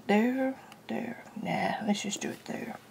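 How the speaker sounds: unvoiced whisper to herself. Real, not acted. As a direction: whisper it under your breath. Talking to herself while drawing.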